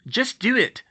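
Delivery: loud, insistent yell; urgent order. Acted. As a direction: shout it impatiently. Urgent order during broom chase.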